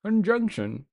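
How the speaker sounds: playful put-on British accent; fancy British accent. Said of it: "say it in, like, a fancy British accent".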